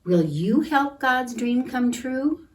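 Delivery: ordinary delivery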